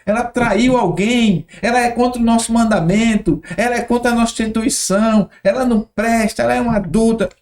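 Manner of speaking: high, mocking voice of the crowd eager to stone her; scornful accuser. A preacher voicing the crowd ready to stone the woman, high and scornful. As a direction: sneer like a self-righteous accuser.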